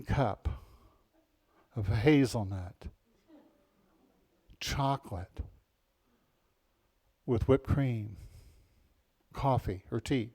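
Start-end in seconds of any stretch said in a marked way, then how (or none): none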